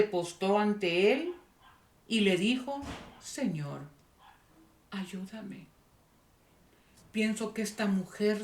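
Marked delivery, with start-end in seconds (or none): 3.2-5.7 soft, low plea falling to a whisper; hushed, imploring prayer; humble plea. Retold or imitated. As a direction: beg very quietly, pausing between the words. Woman kneels and begs Jesus.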